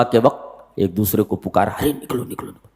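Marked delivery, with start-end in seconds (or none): 1.7-2.7 softer and softer secret calling; hushed call. A narrator's reading. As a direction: urge them out in a hush. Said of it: "Chupke Chupke निकल जाएंगे".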